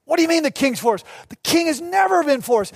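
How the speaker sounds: high, incredulous retort, imitating a crowd; incredulous doubters. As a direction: play the doubters, pitch jumping up. Voicing the doubting crowd's reply.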